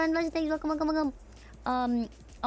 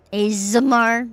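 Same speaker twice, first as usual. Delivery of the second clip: loud; 12 dB louder, slower than usual. Acted. Yelling the word ASMR.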